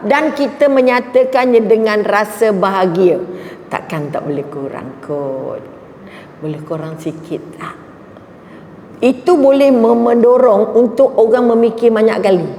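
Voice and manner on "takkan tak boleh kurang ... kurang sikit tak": low, soft pleading for a discount; meek haggling customer. Retold or imitated. Customer softly begging the shopkeeper for a discount. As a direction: coax the seller quietly for a lower price.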